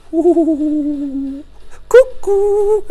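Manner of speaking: high, drawn-out cooing call; sing-song falsetto peekaboo; playful falsetto hoot. Real, not acted. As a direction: coo it loud and very high. Playful greeting on opening the box.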